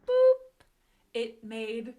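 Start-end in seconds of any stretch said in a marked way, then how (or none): 0.0-0.6 high boop. Acted. Tap on the nose.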